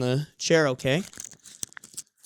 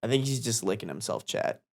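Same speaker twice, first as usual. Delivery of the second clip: normal talking voice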